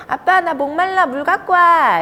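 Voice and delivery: put-on high, loud child's demand; 아빠에게 시키는 아이. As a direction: order dad about like a thirsty kid. (아이처럼 높은 목소리로 조르며)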